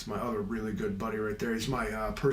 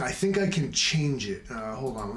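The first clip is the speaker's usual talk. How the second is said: normal talking voice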